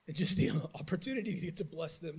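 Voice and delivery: usual speaking voice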